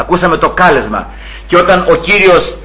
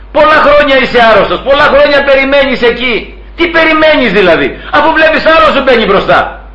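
high, insistent questioning; acted challenge; 8 semitones higher than usual. Preacher challenges the paralytic who waited for years, acted challenge. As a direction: press the sick man with raised pitch.

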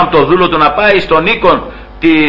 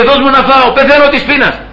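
hungry brother pleading for the stew; starving Esau begging; 7 semitones higher than usual. Retold or imitated. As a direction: beg like a man faint with hunger. Starving Esau begs Jacob.

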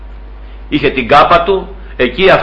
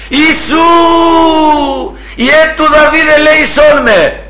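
high, drawn-out pleading call; acted crying out; 10 semitones higher, slower than usual. Blind man calls to Jesus passing by, acted crying out. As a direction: call out high and slow for mercy. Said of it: "αρχίζει να φωνάζει".

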